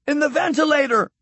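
very high, strained shout without much volume. A narrator's reading. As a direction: call out a sudden alarm, pitched right up. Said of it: "he shouted".

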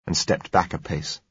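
ordinary delivery, nothing marked

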